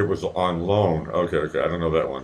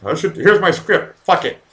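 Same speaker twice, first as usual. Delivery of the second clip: higher, louder offhand Trump voice; mock Trump tossing the script; 8 semitones higher, 5 dB louder than usual. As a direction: brush off the script, then drop it. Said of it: "He's like".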